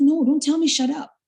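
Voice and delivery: high-pitched, firm retelling of a rebuke; offended retort. Retold or imitated. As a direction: push back firmly, voice up high. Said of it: "because of the values I set".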